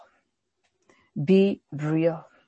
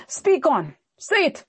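defiant challenge; 8 semitones higher than usual. Retold or imitated. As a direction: taunt the prophet, pitched high. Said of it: "And he said to him".

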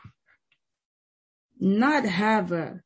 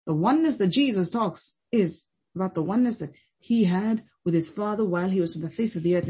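ordinary delivery

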